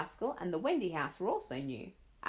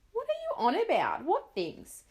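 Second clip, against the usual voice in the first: puzzled mother; 12 semitones higher, 4 dB louder than usual. Acted.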